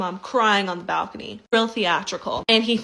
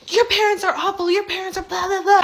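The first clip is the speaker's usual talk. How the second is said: much higher, louder ranting shriek; mock screeching mother-in-law; 10 semitones higher, 4 dB louder than usual. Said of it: "She goes crazy".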